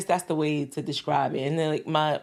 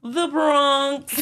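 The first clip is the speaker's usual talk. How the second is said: higher, louder, drawn-out cheer; proud hometown shout-out; 10 semitones higher, 5 dB louder than usual. Real, not acted. Guest proudly tells the interviewer her home borough, proud shout-out. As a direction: announce your borough with gusto.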